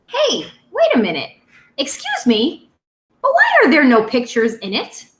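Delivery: put-on lively storytime voice for young kids; reading a picture book aloud. Teacher reading to her second graders, lively storytime. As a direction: read it out to the class with bounce.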